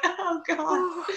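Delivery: high mock sob; fake-choked reaction. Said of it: "And you're like".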